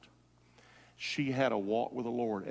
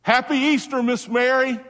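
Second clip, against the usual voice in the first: even higher and louder call; 13 semitones higher, 10 dB louder than usual. Real, not acted. Preacher repeats the Easter call to Miss Mary, louder still. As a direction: throw the greeting out with full force, building.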